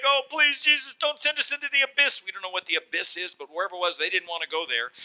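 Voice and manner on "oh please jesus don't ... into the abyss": high, loud demon pleading; hammed-up high-pitched begging. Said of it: "the demons begin to beg".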